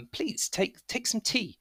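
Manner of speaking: high, soft polite offer; little woman. Acted. Blacksmith's wife offers guests tea, softly polite. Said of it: "a little woman".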